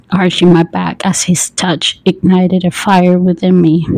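plain reading voice

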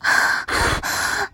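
heavy exhaled panting; breathy sighs. Pretend. Lover spent from pleading, sighing heavily. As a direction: sigh out in quick breaths. Panting within erotic roleplay.

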